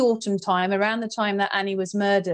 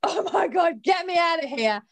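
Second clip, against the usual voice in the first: high, urgent escape plea; retold panic; 7 semitones higher than usual. Woman recalling her escape from the cubicle, high mock panic. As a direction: re-enact a panicked dash out, pitched high.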